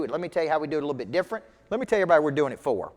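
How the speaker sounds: fast, rattled-off pitch lines; rapid pitch. Shown as a demonstration. Coaching agents how to pitch, rapid and eager. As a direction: rattle the list off quickly. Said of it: "get up there and say".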